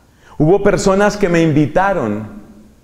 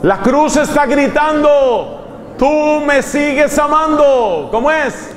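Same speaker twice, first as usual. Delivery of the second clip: higher, slow, shouting praise; fervent preaching cry; 11 semitones higher, slower than usual. Real, not acted. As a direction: proclaim it at full force, like a preacher's climax. Oración que crece hasta clamor. (clamor de predicador, alto, lento y gritado)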